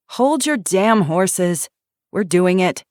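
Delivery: loud, slow, annoyed comeback; grumbling loud protest; irritated retort. Acted. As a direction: tell him to back off, exasperated. Irritated reply to orders.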